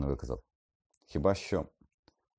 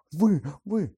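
high, loud accusing cry; outraged shout; 9 dB louder than usual. A narrator's reading. Hermione rounds on Lupin, outraged shout. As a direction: burst out in outrage.

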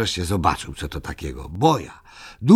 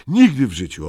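a louder, higher flat no; firm refusal; 6 semitones higher, 8 dB louder than usual. Acted. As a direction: shut the deal down firmly. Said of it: "odparł Muminek stanowczo".

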